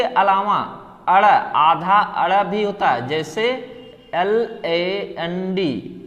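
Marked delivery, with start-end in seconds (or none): 4.1-6.0 एल ए एन डी spelled very slowly; spelled out letter by letter. Shown as a demonstration. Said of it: "इसको क्या पढ़ते हैं?". (एल ए एन डी को बहुत धीरे अक्षर-अक्षर बोलना)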